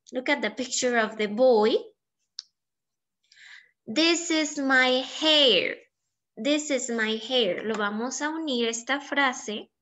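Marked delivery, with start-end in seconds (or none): 3.9-5.8 very slow, with weight on this; first slow model read for pupils to copy; slow model sentence. Shown as a demonstration. Teacher models the sentence, then repeats.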